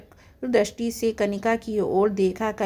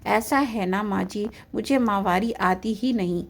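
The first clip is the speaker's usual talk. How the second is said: usual speaking voice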